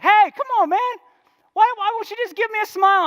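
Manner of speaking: high, loud mocking catcall; catcaller. The guy calling to the preacher, catcaller voice. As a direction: needle him, a touch of the catcaller. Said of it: "he called me".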